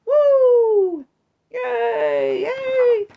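high gleeful woohoo; excited whoop. Acted. Freed spirit cheering, excited whoop. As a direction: cheer like you're flying free.

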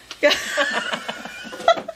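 unvoiced, high, wheezing laugh; a mother wheezing with laughter at her own prank; breathless high laugh. Real, not acted.

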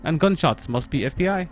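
put-on game text read in a light Russian accent; mild Russian accent storytelling. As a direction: narrate as Ivan with a Russian accent. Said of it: "my Russian accent is Ivan's inner monologue".

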